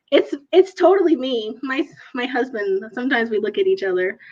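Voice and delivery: ordinary delivery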